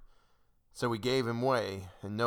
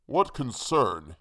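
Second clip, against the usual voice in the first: slow, loud, put-on hangman voice; lightly voiced hangman's retort; 5 dB louder, slower than usual. A narrator's reading. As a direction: stretch the words into a rebuke.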